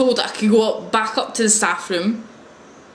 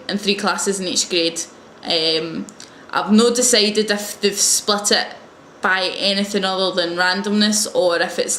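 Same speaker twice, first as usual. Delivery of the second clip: ordinary talk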